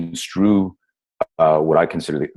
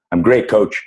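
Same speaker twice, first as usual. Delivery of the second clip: lifted, bright-sounding fake confidence; forced confident lie; 6 semitones higher than usual. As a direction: sell the lie to the coach. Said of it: "I lied to him".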